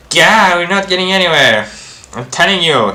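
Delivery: high, loud frustration; exasperated outburst. Acted. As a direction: burst out, fed up with the argument. Frustrated at circular debate.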